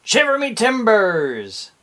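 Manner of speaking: mock pirate surprise. Shown as a demonstration. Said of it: "another expression of surprise".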